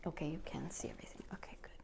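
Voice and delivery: quick whispered aside, barely voiced. Real, not acted. Tarot reader checks her camera view, whispering.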